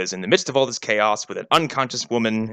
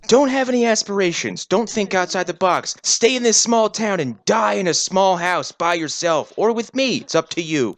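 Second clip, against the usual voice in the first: mock controlling boyfriend; 6 semitones higher than usual.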